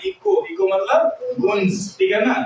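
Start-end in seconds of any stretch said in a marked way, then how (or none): 0.0-0.4 इको with a plain K sound, no H; silent H in इको. Shown as a demonstration. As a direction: say इको with C as K and the H unheard. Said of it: "एच नहीं पढ़ रहे हैं". (इको में एच छोड़कर, सी को क पढ़ते हुए)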